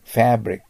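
Fabric with a strong American "a" as in cat; 強いアの発音. Shown as a demonstration. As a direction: hit the first vowel of Fabric hard. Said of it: "Aの字にアクセントがあとあるときには強く発音します". (「Fabric」のAをアメリカ式に強く)

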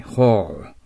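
ホール with a long drawn-out "o"; long vowel. Shown as a demonstration. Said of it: "伸びます".

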